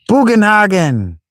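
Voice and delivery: high drawn-out call, falling away; youngster's excited hail. Acted. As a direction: call for the old man from the doorway. Character calls out the old man's name.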